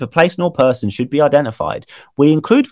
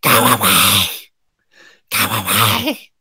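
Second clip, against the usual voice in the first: put-on hissed, slowing old woman's warning; angry old woman whisper; 3 semitones lower, slower than usual. Shown as a demonstration. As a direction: whisper it angrily like a ghost. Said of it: "I'll try and whisper angrily".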